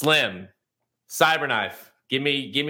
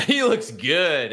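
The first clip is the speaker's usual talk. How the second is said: laughing; 4 semitones higher, 5 dB louder, much slower than usual. Retold or imitated. Retelling his reaction, laughing.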